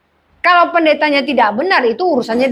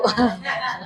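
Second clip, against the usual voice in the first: short laugh; 5 semitones lower, 9 dB quieter than usual. Real, not acted. Laughs at own joke.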